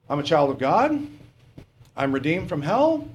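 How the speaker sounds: ordinary delivery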